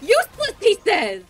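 high loud incredulous laughing; laughing shout. Real, not acted. Laughing at teammate's insult.

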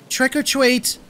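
put-on very high, enthusiastic little-boy voice; squeaky child trick-or-treater; Howie, enthusiastic cry. A narrator's reading. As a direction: cry it out like an eager five-year-old. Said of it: "Howie cried enthusiastically".

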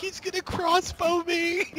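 imitating crying. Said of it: "he was like, crying".

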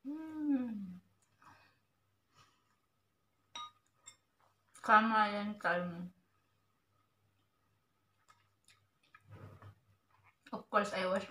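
eating with hands; chewing food. Real, not acted. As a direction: mouth full while talking. Seen 1: Hand feeds mouth, cheeks bulge and jaw chews while speaking.

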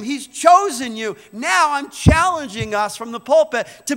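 loud, high, fervent preaching to the church; pulpit-pounding sermon voice; full-force preaching. Acted. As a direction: preach it out to the whole room.

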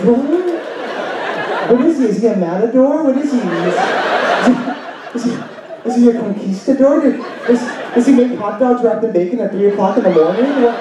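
high, fast, breathless string of questions; mock frantic questions. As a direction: pile on the questions in rising excitement. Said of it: "They'll be".

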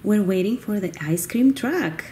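ordinary talk

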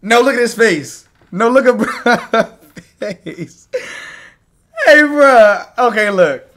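loud, high, laughing outburst; laughing shout. Real, not acted. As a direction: yell through the laughter. Streamer cracking up at clip.

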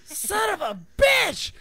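high, drawn-out comic yell; loud, high wail of regret; exasperated shout. Retold or imitated. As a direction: cry it out slowly in mock anguish. Retold outburst after the accident.

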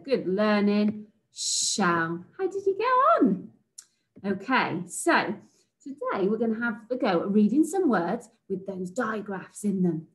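normal talking voice

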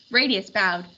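usual speaking voice

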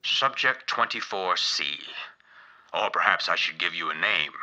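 ordinary talk, nothing marked

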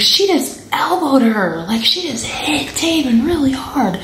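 high-pitched imitation of an indignant child; insistent tattling. Delia explains to mom what the girl did, imitated insistent tattling. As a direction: push it high and urgent, as a retold protest.

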